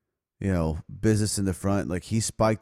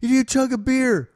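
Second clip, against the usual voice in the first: put-on drunk girl voice. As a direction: call down like a tipsy fan. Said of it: "She's like".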